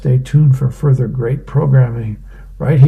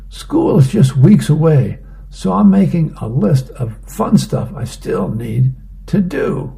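put-on higher-pitched kid pleading his case; protesting boy; 6 semitones higher, 4 dB louder than usual. Boy to mom at bedtime, in higher-pitched protest. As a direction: lightly voice a boy arguing against bedtime.

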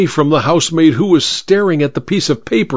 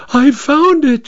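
high, excited cry of discovery. Acted. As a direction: housemaid bursts in, cries out her find. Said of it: "she cried".

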